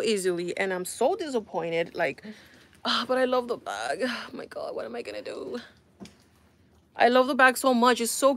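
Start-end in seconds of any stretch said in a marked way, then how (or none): 2.8-5.6 dismayed lament. Real, not acted. Dismayed about a bag she loves.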